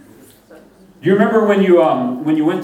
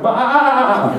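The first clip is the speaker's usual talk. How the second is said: higher, hammed-up sheep bleat with a laugh; 8 semitones higher than usual. Shown as a demonstration. Preacher mocking sermon sound effects, silly sheep bleat. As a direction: bleat like a lost lamb, half laughing. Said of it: "you'll even do sound effects like".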